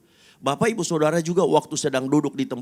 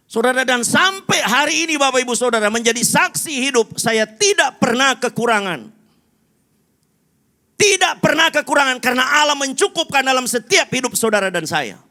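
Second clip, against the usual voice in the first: very high, shouted testimony; full-force preaching; 13 semitones higher, 8 dB louder than usual. Real, not acted. Preacher calling the congregation to witness his life, full-force preaching. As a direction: ring it out like a pulpit proclamation.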